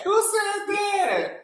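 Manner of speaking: high, slow disbelieving shout; shocked shout. Real, not acted. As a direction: shriek it out in real disbelief. Shocked by gossip claim.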